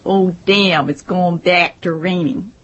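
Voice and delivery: slow, nasal Midwestern accent demo. Shown as a demonstration. Mocking how Midwesterners talk for the interviewer, in a Midwestern accent. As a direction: show off the Midwestern vowels, drawn out. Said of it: "they say things like".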